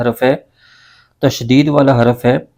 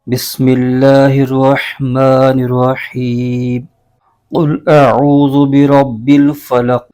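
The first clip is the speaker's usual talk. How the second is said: slow, drawn-out melodic recitation; Quranic recitation; much slower than usual. Shown as a demonstration. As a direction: chant it slowly with tajweed. (تجوید کے ساتھ آہستہ قرآنی تلاوت)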